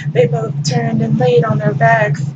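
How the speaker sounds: ordinary delivery, nothing marked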